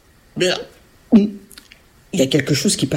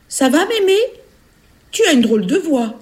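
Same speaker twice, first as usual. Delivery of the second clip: put-on high, questioning child voice; little girl; 10 semitones higher than usual. Little girl outside grandmother's door, high puzzled voice.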